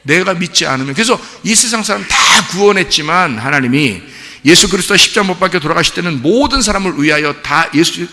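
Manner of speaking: loud, rising preacher's cry; full-force preaching. Real, not acted. Pastor preaching salvation loudly to the congregation. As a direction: drive the point home to the church.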